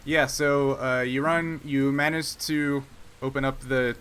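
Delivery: normal talking voice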